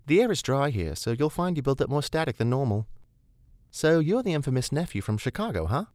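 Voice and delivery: put-on high, easygoing woman's voice, faint accent; Rebecca's faint accent. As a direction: lilt it lightly with a faint accent.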